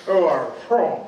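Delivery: light mock Canadian English accent on the question. A Canadian minister's English accent, imitated while retelling the question. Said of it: "এইভাবে।".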